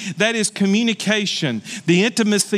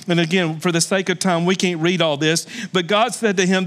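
ordinary delivery

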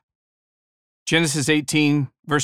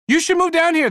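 eager husband; 4 dB louder than usual. Retold or imitated. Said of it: "the man said".

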